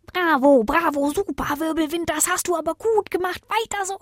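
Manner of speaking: high, cackly cheering; gleeful high praise ending in laughter; praising bramble bush. A narrator's reading. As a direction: egg him on in a high creature voice. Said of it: "lobte der Brombeerstrauch den Wirbelwind".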